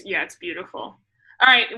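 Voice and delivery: ordinary talk, nothing marked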